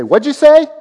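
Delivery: high, loud suspicious "What'd you say?"; suspicious spouse. Retold or imitated. Spouse confronting grumbler.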